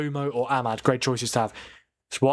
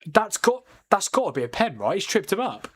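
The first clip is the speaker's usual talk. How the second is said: excited insistence; 6 semitones higher than usual. Real, not acted. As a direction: push the pitch up, convinced. Insisting to chat it's a penalty.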